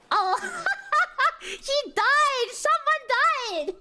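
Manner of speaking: high laughing cry of delight; laughing excitedly. Real, not acted. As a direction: laugh out the news, thrilled. Laughing at another player's death.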